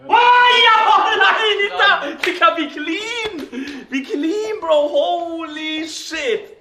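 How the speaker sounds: high, loud, slow amazed cheering; excited. Real, not acted. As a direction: celebrate a perfect shot. Celebrating a clean shot.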